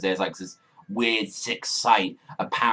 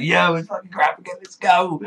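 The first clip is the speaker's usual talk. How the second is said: higher, louder, fast hype yell; excited screaming; 9 semitones higher, 5 dB louder than usual. Retold or imitated. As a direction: retell your own fan scream, fast and high. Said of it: "I was already fucking screaming".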